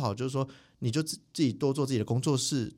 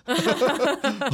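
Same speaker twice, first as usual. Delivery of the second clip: loud laugh; 12 semitones higher, 5 dB louder than usual. Real, not acted. Laughs at the no-wailing sign.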